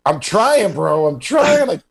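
high, strained mock effort; hammed-up struggling buddy; straining friend. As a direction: squeak it out like you can't land the punch. Acting out friend's failed punches.

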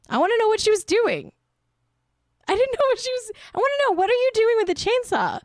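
high, rapid, mock-frantic demand; exasperated curiosity. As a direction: pitch it way up, demanding an answer. Mock-demanding to know about chainsaw.

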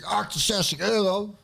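slow, stunned repeat of the price; shocked disbelief. Retold or imitated. Retelling to friends how the taxi price floored him, stunned and slow. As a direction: drag out the sum as if you can't believe it.

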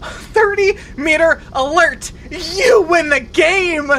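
high, loud cries broken by laughter; elated shouting. Real, not acted. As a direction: yell with joy as you win. Found missing friend, elated.

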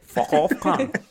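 jokey Australian accent. Real, not acted. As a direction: drag it out in broad Australian. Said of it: "I love your accents".

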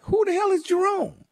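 high-pitched incredulous question; baffled disbelief. Retold or imitated. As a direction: replay your bafflement, voice shooting up. Retelling own baffled reaction.

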